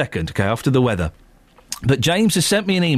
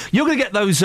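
normal talking voice

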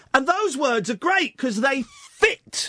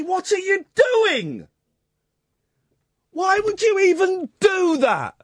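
raised, higher outraged yell at the lyricist; exasperated shout; 6 semitones higher than usual. Real, not acted. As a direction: shout it like you can't believe it. Ranting at the carol writers.

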